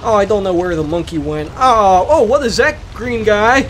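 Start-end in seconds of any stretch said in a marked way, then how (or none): none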